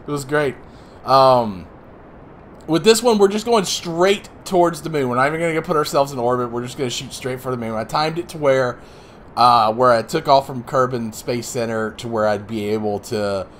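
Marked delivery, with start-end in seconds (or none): none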